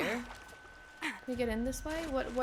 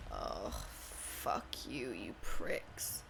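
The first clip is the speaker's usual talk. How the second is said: slow, much lower monster growl; mock-demonic snarl at the enemies; growled curse; 11 dB quieter, much slower than usual. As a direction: drag the curse out low like a beast. Cursing game enemies.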